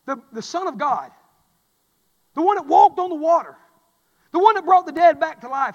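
loud, high, rising praise; preaching at full force. Acted. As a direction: build the Son of God up. Preaching climax.

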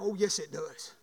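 ordinary talk

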